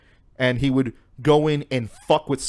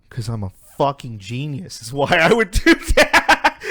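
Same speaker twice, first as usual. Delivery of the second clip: emphatic boast cracking up into laughter; breaking into laughter; 4 dB louder than usual. Real, not acted. Storyteller voicing the scammer's answer, breaking into laughter. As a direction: let the laugh take over the last words.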